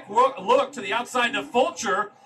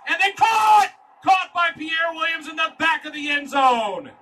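excited shout; 6 dB louder than usual. Real, not acted. Radio call of a touchdown catch.

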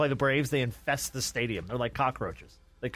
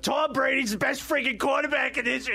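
put-on Boston accent, worked up and pitched high; mock Boston accent; 10 semitones higher than usual. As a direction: imitate a riled-up Boston fan in a Boston accent. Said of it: "the Boston accent's funny when they would get all pissed".

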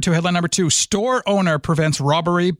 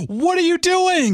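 exasperated disbelief; 8 semitones higher than usual. Real, not acted. Disbelief at robber returning.

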